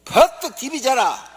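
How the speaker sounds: gruff Gyeongsang husband. Acted. Gyeongsang husband telling wife to roll over, loud dialect.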